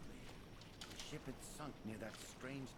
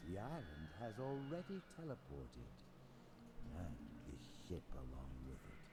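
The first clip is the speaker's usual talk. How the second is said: low, cold, measured god voice; lightly voiced dark god; 8 semitones lower than usual. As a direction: speak down as an all-powerful god. Vecna addressing trapped players.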